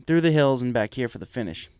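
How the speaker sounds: plain reading voice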